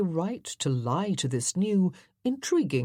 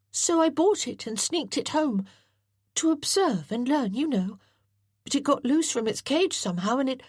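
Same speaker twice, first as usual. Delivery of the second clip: put-on higher boy's voice, hurrying through the confession; anxious boy John; 9 semitones higher than usual. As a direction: rush it out, ashamed but eager.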